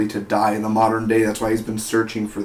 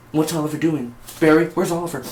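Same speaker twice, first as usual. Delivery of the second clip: higher, nagging snoop voice; mock a nosy busybody pestering with questions; Felicity; 5 semitones higher than usual. Reviewer mimicking snooping teammate asking after Oliver, higher nagging. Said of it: "she's like all like that".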